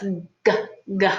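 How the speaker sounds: hard "G" from the back of the throat; over enunciating. Shown as a demonstration. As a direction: spread the mouth and say "G" slowly. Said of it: "sound come from the back of my throat".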